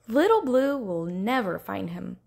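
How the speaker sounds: ordinary delivery, nothing marked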